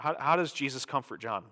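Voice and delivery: normal talking voice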